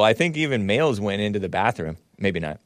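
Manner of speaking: usual speaking voice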